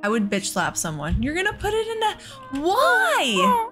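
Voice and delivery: ordinary talk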